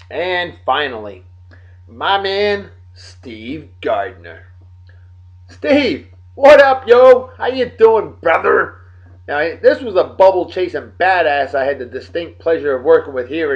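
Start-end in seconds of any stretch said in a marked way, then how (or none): 5.5-8.8 excited greeting. Real, not acted. Calling out to friend Steve.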